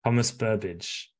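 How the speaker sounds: name said slowly in native English accent; slow, deliberate English accent. Shown as a demonstration. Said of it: "avec ton bel accent anglais".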